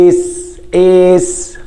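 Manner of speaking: اس drawn out to expose the opening "e"; isolated, stretched اس for the learner; first sound shown. Shown as a demonstration. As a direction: say اس alone, lingering on its first vowel. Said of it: "أنا بنطق أول حرف إيه؟".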